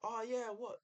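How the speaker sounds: high-pitched mock challenge; put-on confrontational call; retold shouting. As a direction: imitate a heckler's challenge, pitched right up. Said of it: "now he's shouting".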